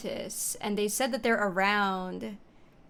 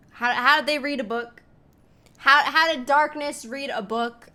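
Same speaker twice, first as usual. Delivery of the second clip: incredulous shout; 3 semitones higher, 7 dB louder than usual. Real, not acted. Ranting at the game's story.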